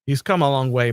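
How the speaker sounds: usual speaking voice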